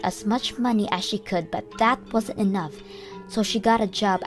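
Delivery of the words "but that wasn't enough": ordinary delivery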